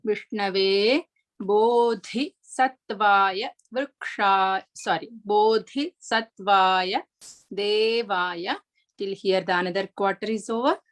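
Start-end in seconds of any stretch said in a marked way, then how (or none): 0.0-8.6 Bodhi Sattvaya Devaya very slowly, with an aspirated dha; careful pronunciation. Shown as a demonstration. Teacher models the third quarter for students, very slow. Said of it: "dha is mahaprana".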